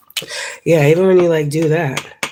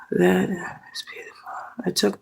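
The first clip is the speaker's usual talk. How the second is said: whisper; 4 semitones higher, 7 dB quieter than usual. Real, not acted. Grateful prayer-like aside.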